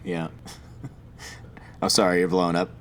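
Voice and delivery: usual speaking voice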